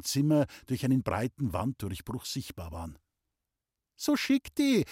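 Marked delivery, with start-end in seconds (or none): 4.0-4.8 put-on very high, ringing girl's call; bright voice. A narrator's reading. Loni heard from the staircase, bright high call. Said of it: "hörte Pauli Lonis helle Stimme von der Treppe her".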